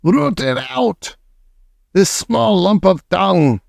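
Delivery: very high, slow screeched order; Grand High Witch hunting the boy; screeching. A narrator's reading. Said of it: "screeched the Grand High Witch".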